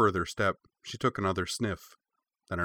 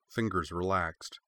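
ordinary delivery

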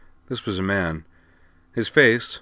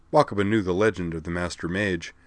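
ordinary delivery

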